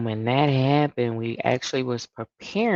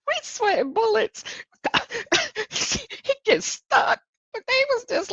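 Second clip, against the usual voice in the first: high, giggling through the words; can barely get it out for laughing; real laughter breaking through the story. Real, not acted. Laughing retelling of relief.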